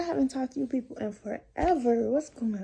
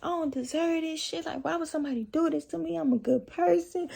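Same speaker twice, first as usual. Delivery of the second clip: higher, tearful self-pity, lightly acted; a touch of mock weeping, pitched up; retold crying self-pity; 7 semitones higher than usual. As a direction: replay your own whiny sob-talk in the car. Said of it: "I'm just like".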